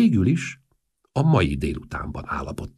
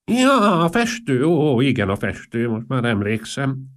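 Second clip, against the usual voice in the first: very high, loud bleat, gradually coming down; bleating old man; 7 semitones higher, 6 dB louder than usual. A narrator's reading.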